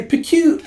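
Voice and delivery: cute pew. Retold or imitated. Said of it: "with his cute".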